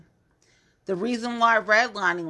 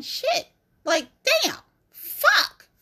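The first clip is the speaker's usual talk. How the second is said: exasperated squeal; 3 dB louder, slower than usual. Real, not acted. Frustrated exclamation about rivals.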